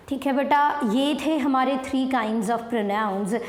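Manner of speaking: ordinary delivery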